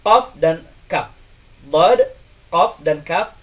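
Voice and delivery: teacher contrasts the two letters slowly; qaf and kaf demo. Shown as a demonstration. Teacher contrasting qaf and kaf for students. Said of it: "dipastikan dia bukan orang Indonesia".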